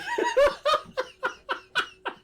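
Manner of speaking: high, real burst of laughter; helpless laughter. Real, not acted. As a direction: crack up at your own story. Cracks up at own story.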